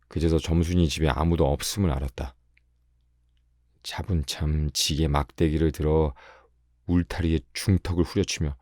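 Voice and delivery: plain reading voice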